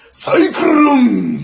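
Wakamoto voice. Retold or imitated. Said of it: "マジで".

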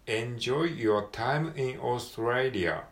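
slow careful English, each word clear; slow model reading. Shown as a demonstration. Teacher reading the textbook line slowly for learners. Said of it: "ここはですね".

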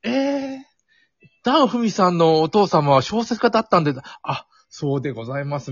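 high, polite gushing TV host; talk show host impression. Host introducing a guest whose father was a novelist, polite gushing impression. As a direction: do a refined hostess politely marvelling at her guest. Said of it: "徹子の部屋みたいな".

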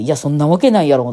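大阪弁で強めにツッコむ; 呆れたように声を張った関西弁の否定; ツッコミ. Retold or imitated. 運転手への心中のツッコミ. (大きめの声で即座にツッコミ)

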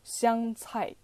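香菜 said slowly in Mandarin, as "xiang cai"; 香菜 with Mandarin sounds and tones; Chinese reading. Shown as a demonstration. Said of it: "中国語だと".

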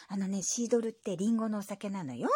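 ordinary delivery

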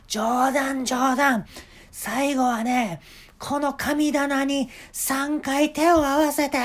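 high, loud eager boss voice; a showman giving orders; eccentric shop owner. Retold or imitated. (店の大将が客に猿になる手順を指示する、高く大きな声)

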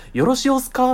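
high, lilting Kyoto-ben よろしおすか; 京都弁で「よろしおすか」; Kyoto dialect imitation. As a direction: mimic the teacher's Kyoto speech. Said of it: "って言ったのね".

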